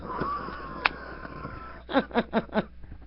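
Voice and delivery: high, silent wheezing laugh; helpless breathless laughter. Real, not acted. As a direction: break up laughing without sound. Can't stop laughing.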